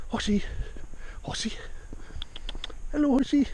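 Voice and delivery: pet talk. Real, not acted. Greeting the horse in pet talk.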